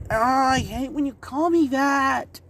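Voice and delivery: high, slow sulky whine; pouty complaint. Acted. Samui sulking at her brother for the nickname, pouty. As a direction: pout the words out. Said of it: "She gets a pouty face".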